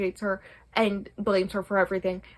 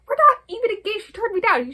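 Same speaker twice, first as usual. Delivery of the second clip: higher and louder, mock exasperated; exasperated protest; 12 semitones higher, 7 dB louder than usual. Son protesting to his mother, high loud mock exasperation. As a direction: protest like a whining grown son.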